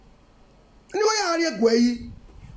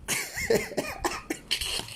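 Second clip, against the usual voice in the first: laugh; 11 dB quieter than usual. Real, not acted. Laughs alone mid rant.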